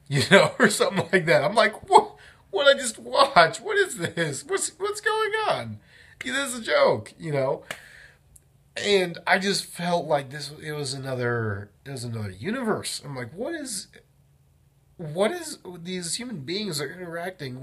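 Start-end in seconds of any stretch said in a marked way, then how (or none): 1.9-7.1 high, incredulous squeak of confusion; high-pitched bewildered what-is-this voice; baffled disbelief. Retold or imitated. Baffled by the acting class. 13.3-17.6 baffled disbelief. Retold or imitated. Baffled by the repetition exercise.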